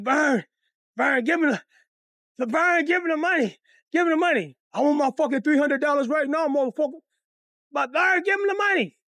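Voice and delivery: high, urgent plea to his wife; desperate begging husband. Retold or imitated. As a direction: beg loudly for your life. Father desperately begs wife.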